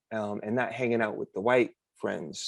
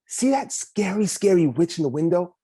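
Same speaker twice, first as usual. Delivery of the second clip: excited; 6 semitones higher, 5 dB louder than usual. Acted. Kid pointing out witch.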